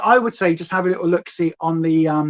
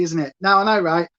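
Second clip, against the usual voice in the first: usual speaking voice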